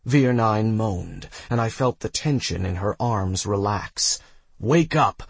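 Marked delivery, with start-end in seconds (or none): 4.6-5.2 higher, urgent yell, moderately loud; a short raised call to rouse someone. A narrator's reading. Carrier rousing two fading wounded women, mild yell. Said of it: "I yelled".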